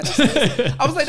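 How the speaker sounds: says it through a laugh; chuckling at the memory; amused aside, laughing. Real, not acted. Laughing about the angry audition.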